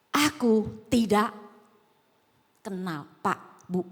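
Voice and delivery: ordinary talk, nothing marked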